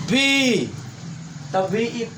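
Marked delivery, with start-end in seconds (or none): 0.1-0.7 "बी" said quickly; quickly spoken "बी". Shown as a demonstration. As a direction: snap out "बी" fast but still sound the ई. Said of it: "तो आप बहुत तेजी से कहोगे".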